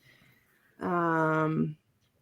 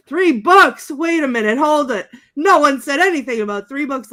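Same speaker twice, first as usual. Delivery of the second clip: high, loud, flustered protest in imitation; mock confusion; 7 semitones higher, 8 dB louder than usual. Ash finds there are three books, loud mock confusion. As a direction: protest loudly, as if thrown by the news. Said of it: "why he's so confused".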